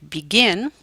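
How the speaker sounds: "begin" with a light start and punched ending; stress on second syllable. Shown as a demonstration. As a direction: hit the GIN of begin. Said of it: "stress is on the second syllable".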